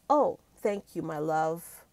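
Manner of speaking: normal talking voice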